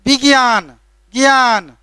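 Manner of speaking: विज्ञान, ज्ञान said "bigiyan, giyan"; Khortha pronunciation. Shown as a demonstration. Teacher showing our local forms, Khortha g in विज्ञान and ज्ञान. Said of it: "हम लोग ज्ञान बोलते हैं".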